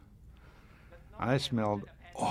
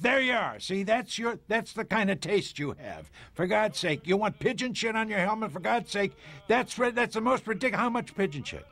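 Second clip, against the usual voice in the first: agitated outburst; 11 semitones higher than usual. Acted. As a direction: sputter it out, outraged at the taste. Agitated film dialogue, Franz scene.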